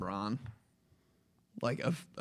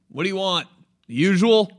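louder brute bartender; gruff bartender elf; 7 semitones higher, 11 dB louder than usual. Pretend. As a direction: grunt at a regular. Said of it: "he's brute".

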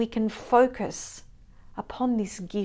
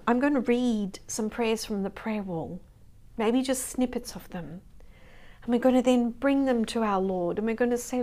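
normal talking voice

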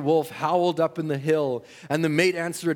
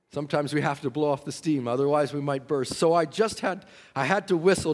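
normal talking voice

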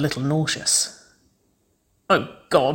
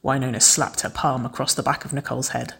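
ordinary delivery, nothing marked

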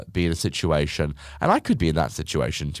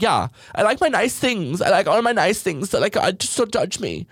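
higher, airy mock girl voice; mocking her voice; 12 semitones higher than usual. Vlogger mimics the wife defending nice things, mock prissy. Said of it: "she was just like".

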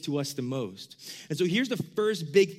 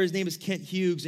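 normal talking voice

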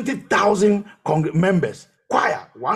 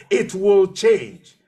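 louder, slow and emphatic mock yell; drawn-out, forceful imitation of screaming; retold scream; 5 dB louder, much slower than usual. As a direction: retell your own insistent cry, stretching each word. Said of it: "I was screaming on top of my voice".

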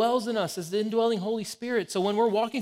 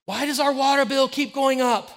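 higher and louder, fed-up question about the bill; exasperated; 8 semitones higher, 6 dB louder than usual. Retold or imitated. Wife reading the water bill, exasperated. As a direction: throw up your hands at the number.

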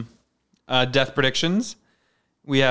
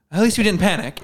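higher-pitched cartoonish relief; mock-relieved punchline, pitched up; kids' show bit; 6 semitones higher, 4 dB louder than usual. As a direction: deliver it like a hammy kids' show character. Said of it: "bit where it's on kids shows".

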